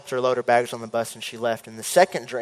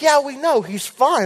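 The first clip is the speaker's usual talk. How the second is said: higher, breezy unconcerned reply; casual parents; 11 semitones higher than usual. Retold or imitated. Parents brushing off the wizard, breezy and higher. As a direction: shrug it off lightly.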